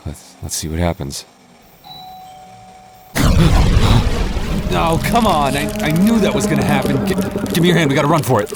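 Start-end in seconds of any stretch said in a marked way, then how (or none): 3.1-4.7 sharp high gasp of sudden fright; startled gasp. Acted. Boyfriend sees skeletons move, sharp frightened gasp. 4.7-8.5 high, loud, frantic yelling while fleeing; panicked shout. Acted. Boyfriend grabbing partner's hand to flee skeletons, panicked high shout.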